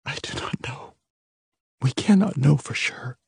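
mother near tears telling her daughters; tearful. A narrator's reading. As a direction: close to tears, hushed. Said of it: "her eyes filling with tears".

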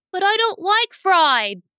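put-on high, loud, slow child's whine; whining little boy. A narrator's reading. Little brother who doesn't like fried eggs, high loud whine. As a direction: protest like a small kid, drawn out and high.

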